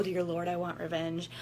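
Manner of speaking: ordinary talk, nothing marked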